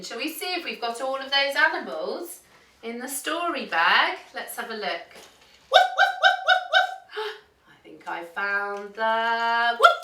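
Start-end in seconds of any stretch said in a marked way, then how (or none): 5.7-7.3 rapid high-pitched woofs; playful dog barks. Pretend. Storyteller finding the toy dog in the bag, high yapping. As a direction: yap like an excited little dog.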